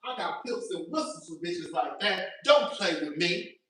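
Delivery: low, soft mock Kenya voice; a hint of a cool threat, understated; lightly voiced castmate; imitating Kenya. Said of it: "Bang Bang ... talking about".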